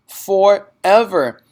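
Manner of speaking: high, loud, drawn-out wail; child crying out to her teacher; anguished cry. Acted. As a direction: stretch it out in despair. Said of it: "Matilda cried".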